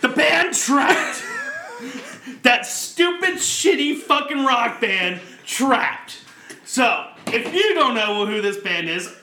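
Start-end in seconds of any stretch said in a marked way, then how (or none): 0.0-6.2 disgusted rant. Real, not acted. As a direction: spit the name out in disgust. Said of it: "you know what chaps my ass?".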